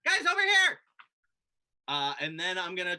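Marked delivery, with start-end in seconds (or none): 0.0-0.8 high, loud call to friends at a distance; urgent raised-voice shout across the way; yelling out. Acted. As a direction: holler to get their attention. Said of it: "I yell out".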